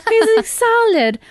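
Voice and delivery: put-on high, loud British child voice; British little boy. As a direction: squeal it like a delighted British kid. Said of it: "so it's double British accent".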